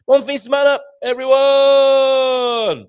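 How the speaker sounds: high, loud, long-held shout to everyone; drawn-out call. Acted. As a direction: stretch the last word out as a call. Calling out weekend greeting.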